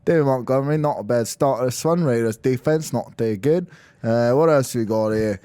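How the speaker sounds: hammed-up London pundit; London accent. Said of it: "talk in a London accent".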